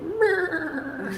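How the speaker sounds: high whiny bleat, imitating a friend; whiny meh. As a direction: squeak out a pitiful whine. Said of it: "he was like".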